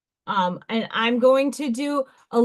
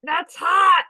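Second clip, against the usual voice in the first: exclaiming; 7 semitones higher, 6 dB louder than usual. Real, not acted. Reacting loudly to chat comment.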